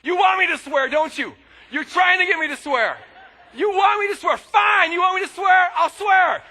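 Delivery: loud, high, furious outburst; angry shouting at God. Retold or imitated. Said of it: "I'm getting madder and madder and madder".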